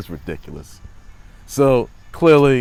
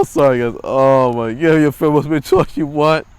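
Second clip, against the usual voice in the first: ordinary talk, nothing marked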